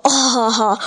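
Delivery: spoken ho-ho chuckle; mild, put-on chortle; storybook laugh. A narrator's reading. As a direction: act out the big fish's laugh. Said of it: "大鱼笑着说".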